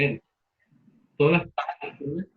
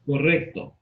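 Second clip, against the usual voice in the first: ordinary delivery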